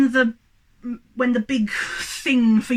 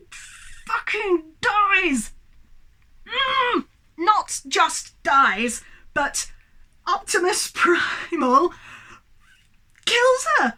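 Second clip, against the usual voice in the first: high-pitched, drawn-out outrage; slow down and squeeze out each word in indignation; outraged rant; 6 semitones higher, much slower than usual. Real, not acted. Ranting about favourite character's death.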